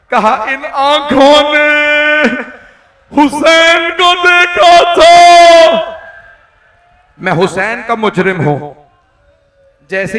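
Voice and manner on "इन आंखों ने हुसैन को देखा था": high, slow, shouted lament; full-force mourning recital; wailing sermon climax. Acted. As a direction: cry the words out in grief, stretching each syllable.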